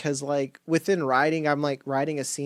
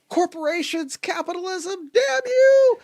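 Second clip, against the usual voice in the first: mock railing; 12 semitones higher than usual. Cohost jokes about cursing faceless villains, mock railing.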